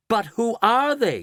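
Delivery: crying out. Acted. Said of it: "cried Shanks".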